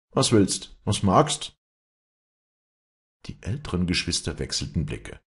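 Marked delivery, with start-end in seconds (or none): none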